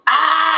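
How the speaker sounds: sudden high shriek of fright; scream. Retold or imitated. Girl opening the fridge, high scream. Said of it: "She starts screaming".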